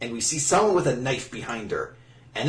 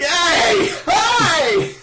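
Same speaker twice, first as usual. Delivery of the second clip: very high, loud squeal; cartoonish little-sister protest; indignant character yell; 7 dB louder than usual. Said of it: "She's like".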